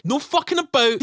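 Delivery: normal talking voice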